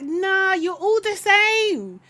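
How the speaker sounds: loud, high, drawn-out dismissal; loud exasperation. Real, not acted. Exasperated verdict on all the islanders.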